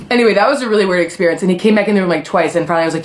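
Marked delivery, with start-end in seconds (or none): none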